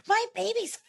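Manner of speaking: high-pitched, exasperated defence; mock indignant mom. Mom to a lady in a store, mock indignant.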